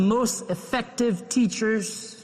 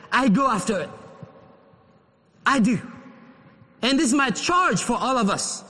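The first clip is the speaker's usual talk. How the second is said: ordinary talk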